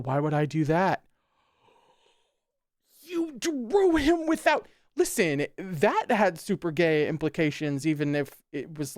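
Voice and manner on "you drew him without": high, slow, incredulous; exasperated squeal. Real, not acted. Reader exasperated at the character, high squeal. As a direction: squeak out disbelief.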